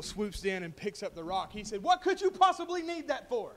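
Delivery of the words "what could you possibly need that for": high, loud acted disbelief; indignant, raised-voice protest to a thief; exasperated outburst. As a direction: cry out in mock exasperation. Bull exasperated as eagle takes rock.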